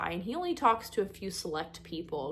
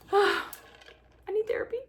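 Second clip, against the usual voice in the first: mock despair; 12 semitones higher than usual. Real, not acted. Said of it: "before I start crying".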